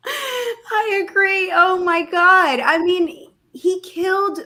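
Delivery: high, slow laughing wail; half-laughing, half-crying outburst. Real, not acted. Reacting to Cuomo video.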